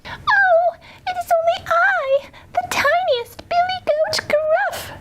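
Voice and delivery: tiny, very high-pitched little goat; very small voice. A narrator's reading. Tiniest billy goat answers the troll, in a very small voice. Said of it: "in his very small voice".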